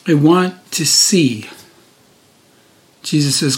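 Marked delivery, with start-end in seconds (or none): 0.1-1.5 slow, each word set apart; deliberate repeat. Retold or imitated. Preacher repeating the blind man's wish, slow and deliberate. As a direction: say it slowly for weight. Same words repeated for weight.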